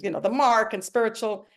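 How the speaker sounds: ordinary talk, nothing marked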